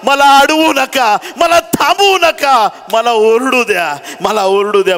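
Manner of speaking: building to a high, loud cry, then easing; impassioned preacher crying out as the beggar; desperate pleading. Acted. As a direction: beg at the top of your voice to be heard. Beggar begs to cry out, acted.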